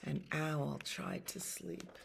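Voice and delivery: plain reading voice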